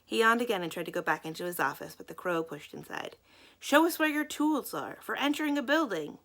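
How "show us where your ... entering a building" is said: bullying. Acted. Crows bully the policeman.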